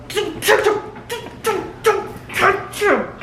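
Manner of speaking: high, desperate repeated don't; frantic high don't don't, slowing at the end; panicked pleading. Real, not acted. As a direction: beg the screen not to let it happen. Pleading at screen.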